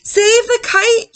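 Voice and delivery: high, loud, slow shout; urgent storybook shout. Acted. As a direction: yell to rescue the kite. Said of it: "shouted Mole".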